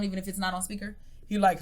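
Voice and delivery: normal talking voice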